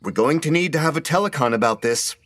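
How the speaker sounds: calling after her. A narrator's reading. Devon after fleeing Sherry about a telecon, calling out. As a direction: call after a departing coworker. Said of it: "he called after her".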